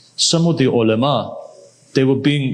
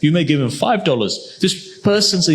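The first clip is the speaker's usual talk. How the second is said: ordinary talk, nothing marked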